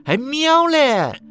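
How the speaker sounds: very high, slow, loud scolding cry; angry snap. Acted. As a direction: bite back at the meow. Pamela snaps.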